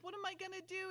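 high mock lament; mock whine. [name] asking Lee what to do, high mock whine. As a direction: whine it helplessly. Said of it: "It's like".

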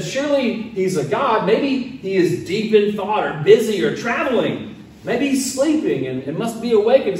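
high, forceful teasing; taunting. Acted. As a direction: needle them with fake helpful guesses. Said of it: "began to taunt them".

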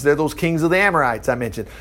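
usual speaking voice

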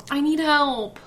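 usual speaking voice

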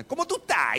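loud, high Dominican greeting; Dominican Spanish. Shown as a demonstration. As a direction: show off lively Dominican Spanish. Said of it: "it's just really fast and really cool".

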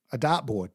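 "dartboard" without its r, as daht-bawd; Bostonian dropped R. Retold or imitated. Mother-in-law repeating the gift to him, R dropped. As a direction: say "dartboard" as dot-board, R dropped. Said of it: "She pronounced it dot".

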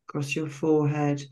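slow, calm meditation voice with long pauses between phrases; guided meditation. Real, not acted. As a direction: guide listeners gently into relaxation, phrase by unhurried phrase.

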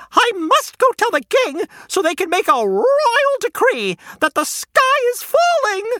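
a flustered chicken rushing to the king; frantic hen. Acted. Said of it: "Henny clucked".

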